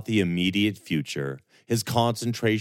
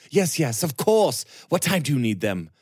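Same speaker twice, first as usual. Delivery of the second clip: tumbling eager words, higher and louder; excited; 9 semitones higher, 4 dB louder than usual. Acted. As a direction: rush it out, eager lad. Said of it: "words fell over each other in his excitement".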